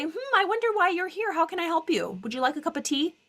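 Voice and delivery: high, sugary welcome to an unwanted guest; mock polite host. Therapist mocking a gracious welcome to an intruder, high sweet voice. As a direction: play the overly gracious host, pitched high. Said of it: "and say".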